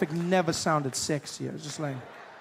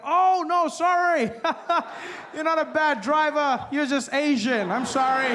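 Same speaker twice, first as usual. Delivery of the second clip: high, loud, laughing fake apology; mock apology; 12 semitones higher, 7 dB louder than usual. Driver pulling alongside, loud laughing mock apology. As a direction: gush a sarcastic sorry.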